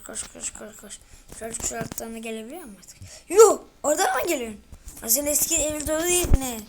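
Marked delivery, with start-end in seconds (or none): 3.3-4.6 high, loud exclamation; startled cry at the monster; shocked yell. Real, not acted. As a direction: jolt of disbelief.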